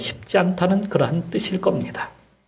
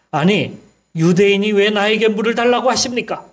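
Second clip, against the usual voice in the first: higher, pointed retort from the Samaritan woman; irritated woman's retort; 6 semitones higher, 3 dB louder than usual. Retold or imitated. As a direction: fire it back at the stranger asking for water. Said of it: "이렇게 말을 하는 거였어요". (짜증 섞인 여인의 높은 목소리로 되받아치듯)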